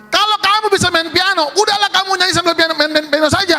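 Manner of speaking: high-pitched scolding; galak, fierce. Retold or imitated. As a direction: imitate a cranky musician snapping at the singer. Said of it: "galak amat". (omelan galak bernada tinggi)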